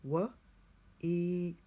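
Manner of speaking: "week" drawn out sound by sound. Shown as a demonstration. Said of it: "Here's the next one".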